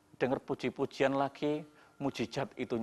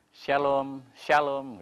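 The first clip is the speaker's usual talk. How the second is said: greeting; 2 semitones higher, 6 dB louder, much slower than usual. Retold or imitated. Acting the church members' greeting.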